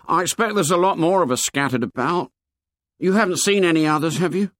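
put-on high-pitched, earnest boy's voice; high boyish character voice; fat schoolboy. A narrator's reading. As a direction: play an anxious plump schoolboy, pitched high.

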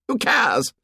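high, scornful retort; defiant. Acted. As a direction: toss it back defiantly, pitched high. Acted quarrel, Jack dismisses rules.

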